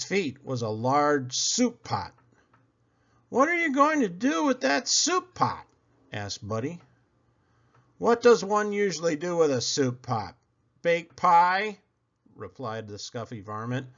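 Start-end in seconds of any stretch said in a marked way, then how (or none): 3.3-5.7 high, puzzled little bunny; a small rabbit who misheard; Buddy voice. Acted. Buddy puzzled by his father's request, high voice. 8.0-11.8 slightly higher, a touch of scorn; mock him for asking; unkind varmint. Varmint sneering at Buddy's question, mildly scornful. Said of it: "not too kindly".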